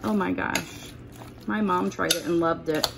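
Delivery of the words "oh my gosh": ordinary talk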